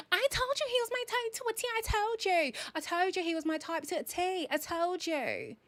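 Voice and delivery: high, fast mocking imitation of Tyler. Viewer mocking Tyler's boast on a live, high mocking voice. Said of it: "Tyler saying".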